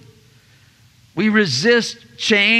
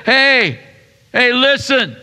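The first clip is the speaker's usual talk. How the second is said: mock shout; 5 semitones higher, 3 dB louder than usual. Sanballat shouting to crowd.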